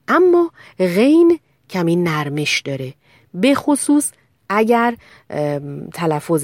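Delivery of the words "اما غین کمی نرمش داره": ordinary talk, nothing marked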